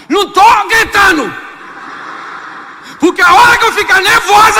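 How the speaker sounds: high, loud mock yell of denial; mock angry shouting wife. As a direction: yell that you are not yelling. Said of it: "ela sabe que tá gritando". (esposa gritando que não está gritando)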